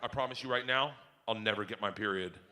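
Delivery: low, hushed solemn promise; deadpan earnest. Retold or imitated. Daughter promising dad in the pool, deadpan. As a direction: vow it quietly, dropping low. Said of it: "Looks at me dead in the face".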